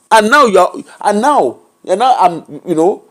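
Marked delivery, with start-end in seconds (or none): none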